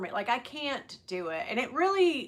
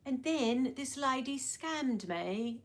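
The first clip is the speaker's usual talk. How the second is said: playful British accent imitation; soft, drawn-out British accent; Sophie Choquette; 2 semitones higher, 4 dB quieter, much slower than usual. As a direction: mimic a British vlogger's accent, slowly. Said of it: "I watched Sophie Choquette today and".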